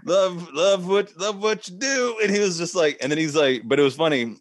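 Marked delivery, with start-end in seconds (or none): 0.0-2.2 put-on high, drawn-out drunken stammer; drunk slurring. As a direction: slur it slowly, too drunk to get words out. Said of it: "too drunk to really, like, v-, voice".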